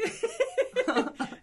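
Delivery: a sudden high laugh at the denial; high burst of laughter. Real, not acted. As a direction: break out laughing, high and bright.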